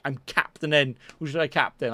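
ordinary talk, nothing marked

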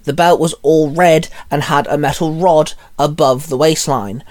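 ordinary delivery, nothing marked

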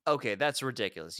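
ordinary talk, nothing marked

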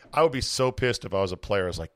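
ordinary delivery

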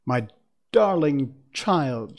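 slow, heartfelt exclamation; joyful cry. Acted. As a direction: cry it out, stretching each word. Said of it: "she cried".

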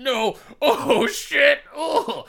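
high, loud drawn-out cries of alarm; exaggerated panicked howl; mock agony. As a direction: wail as if being stabbed, hammed up. Retold victim being stabbed.

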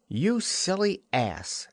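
slow, high-pitched indignation; passionate cry. A narrator's reading. Tinker Bell insults Peter, crying out in a passion. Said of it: "cried Tinker Bell in a passion".

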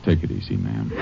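soft and low, calming a grieving woman; gentle comfort. Acted. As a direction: hush her grief tenderly. Ranger comforts the grieving mother.

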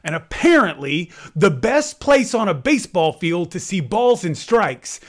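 mock sincere speech. Umpire mockingly addressing the coach, loud sarcasm. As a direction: play it earnest but mocking. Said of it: "he turns to the coach and says".

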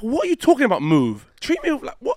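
mock outrage at being ordered about; incredulous retort. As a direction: throw it back at him in outrage. Retorting to a rude judge.

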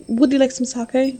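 high, loud nervous blurt. Acted. Heroine flustered by the demon's closeness, blurting high and loud. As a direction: burst out with the offer before thinking. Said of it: "you blurt".

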